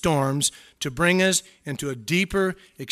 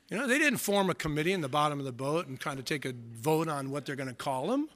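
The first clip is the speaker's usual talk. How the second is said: usual speaking voice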